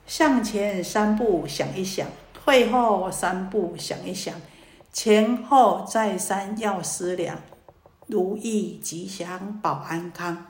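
slow, weighty recitation of a verse; a monk intoning a wise saying, slowly; slow recited verse. Acted. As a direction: lay each line down slowly like scripture.

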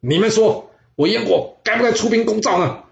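high, loud challenge flung at the court; king furiously asking his ministers whether to attack; angry question. Acted. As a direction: demand an answer from his ministers in a fury. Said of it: "他气得问在场的大臣们".

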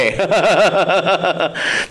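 hearty burst of laughter. Real, not acted. Storyteller after revealing the ghost, laughing heartily.

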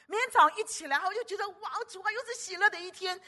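high, delighted morning exclamation; joyful retold exclaim. Sister describing waking to joy: high, delighted exclaiming. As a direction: burst out like waking up happy.

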